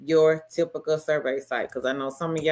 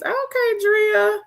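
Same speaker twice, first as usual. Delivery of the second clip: high excited cheer; 3 dB louder, slower than usual. Real, not acted. Cheering a viewer.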